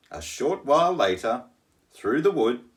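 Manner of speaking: ordinary delivery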